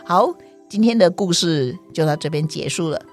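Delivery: plain reading voice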